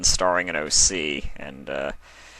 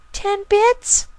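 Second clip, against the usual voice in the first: very high filly voice rising in disbelief; can't believe the stakes; surprised filly; 4 dB louder than usual. Acted. Filly asking her brother about the bet, very high and rising.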